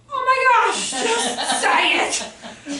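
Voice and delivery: 声音大幅升高变响，笑着催促; 心里急得大喊，带着笑意; 急切的内心呐喊. Retold or imitated. Inner exasperation at shy child.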